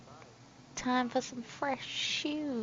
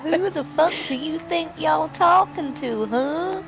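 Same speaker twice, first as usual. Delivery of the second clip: louder, higher hammed-up British tough-guy challenge; mock angry British accent; 4 semitones higher, 8 dB louder than usual. Boss lady hams up a British accent to pick a fight. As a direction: play a furious Brit picking a fight. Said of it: "my impression".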